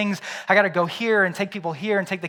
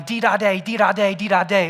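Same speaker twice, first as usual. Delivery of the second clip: loud, high sing-song chant; a little girl yelling with joy; joyful yelling; 3 semitones higher, 7 dB louder than usual. Retold or imitated. Little girl dancing round the bathroom, joyful sing-song yell. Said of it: "starts running around the room yelling".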